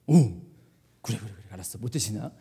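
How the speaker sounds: very low, soft acknowledgement; muted aside. Real, not acted. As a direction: drop almost under your breath.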